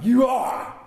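high, loud pretend indignation; mock outrage. Scrooge feigning anger at Bob's lateness, loud and high.

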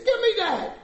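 very high-pitched mock outburst; exasperated parent. Dad to child drinking yet again, at wit's end. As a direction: yelp the order, out of patience.

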